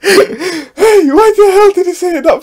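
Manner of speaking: loud, very high squeal; outraged disbelief. Real, not acted. Reacting to a line said too fast, outraged squeal. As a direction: shriek the question in disbelief.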